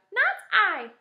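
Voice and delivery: very high, loud little mouse; mouse's line. A narrator's reading. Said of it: "said the mouse".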